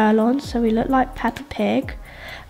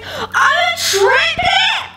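shocked triplet cry; much slower than usual. Acted. Pig character learning she is a triplet, high shocked shriek. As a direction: shriek the news with laughing disbelief.

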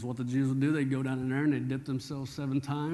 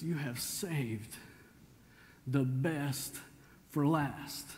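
slow, drawn-out, softer awe; slow savoring; 5 dB quieter, much slower than usual. Retold or imitated. Steward marvelling to bridegroom about the good wine. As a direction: savor each word in wonder. Excited head steward quoted.

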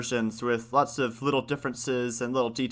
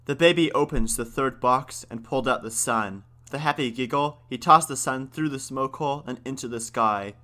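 usual speaking voice

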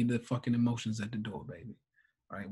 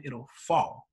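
ordinary talk, nothing marked

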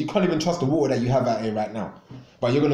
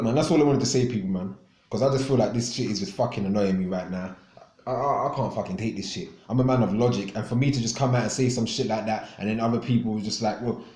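ordinary talk, nothing marked

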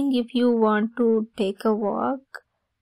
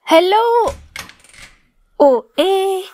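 high, loud greeting call; a raised hello across the street; 8 semitones higher, 6 dB louder than usual. Acted. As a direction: call out brightly to someone arriving. Greeting friend arriving at door.

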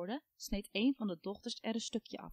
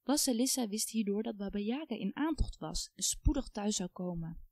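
ordinary delivery

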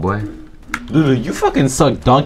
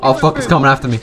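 ordinary talk, nothing marked

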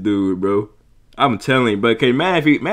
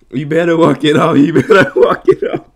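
lightly mocking imitation, higher and louder; raised, amused mimicry breaking into a laugh; mimicking the comedian; 5 semitones higher, 6 dB louder than usual. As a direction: parrot the punchline through laughter.

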